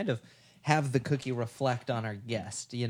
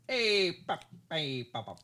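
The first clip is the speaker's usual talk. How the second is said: "Hey, pop" from the side of the mouth, first one higher; old-timey voice; 4 semitones higher than usual. Shown as a demonstration. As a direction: try an old-timey tough-guy line. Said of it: "Give me an example".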